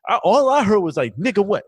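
high, loud agitated retelling; worked-up explaining. Retold or imitated. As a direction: defend himself, flustered. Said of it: "He goes".

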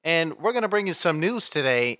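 slow announcer voice; news anchor intro. Acted. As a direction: open the segment like a broadcaster.

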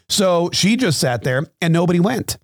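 ordinary delivery

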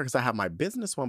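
ordinary delivery

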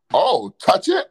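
ordinary talk, nothing marked

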